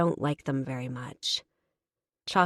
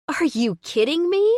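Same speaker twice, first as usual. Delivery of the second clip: much higher and louder outraged cry; indignant cry; 10 semitones higher, 6 dB louder than usual. Acted. Woman outraged by the stranger's crude line, crying out. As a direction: burst out in disbelief. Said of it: "I cry".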